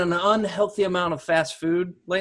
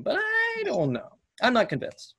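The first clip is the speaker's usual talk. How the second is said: mock denial; 8 semitones higher, 4 dB quieter than usual. Comic denial about fast food.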